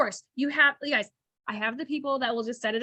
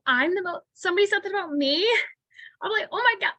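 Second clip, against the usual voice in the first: high-pitched, flattered disbelief; amazed reaction; 7 semitones higher than usual. Acted. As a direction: act floored by a lovely compliment. Acting thrilled recipient of a compliment.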